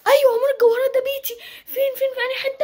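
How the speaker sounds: put-on high fast kid chatter; excited child. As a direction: rush it out like an eager little kid. Child excitedly asks again.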